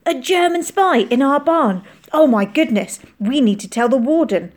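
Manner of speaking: wide-eyed astonishment. A narrator's reading. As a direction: blurt it out, thrilled and alarmed. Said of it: "his eyes huge".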